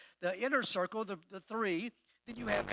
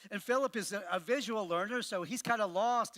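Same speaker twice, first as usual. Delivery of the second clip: ordinary talk, nothing marked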